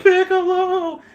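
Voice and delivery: very high, drawn-out cry of a name; mock cry. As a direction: call it out high like an astonished crowd. Imitates everyone crying out Piccolo's name.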